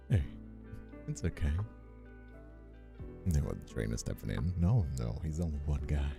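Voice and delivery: ordinary talk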